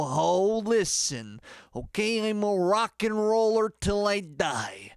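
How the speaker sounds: strut through it, stretching the words big; rock-and-roll persona. Shown as a demonstration. Band coach showing his rock and roll personality, hammy rock-star swagger. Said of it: "I don't talk like this".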